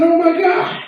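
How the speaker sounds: high, breathless mock rapture; touched gushing. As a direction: gush like you've been blessed. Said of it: "I said".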